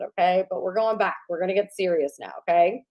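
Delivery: ordinary talk, nothing marked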